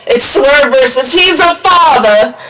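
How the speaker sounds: high, slow mock reverence. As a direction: make fun of the nickname. Mocking the nickname.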